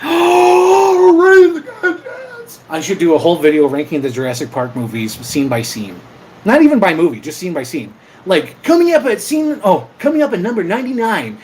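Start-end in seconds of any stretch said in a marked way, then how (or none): 0.0-2.6 loud gasping high squeal; gasp, then wail it high and loud; over-the-top outburst. Pretend. Over-the-top reaction to viewer request. 8.6-11.4 high, punchy mock countdown announcement; countdown host. Mimics a countdown show host.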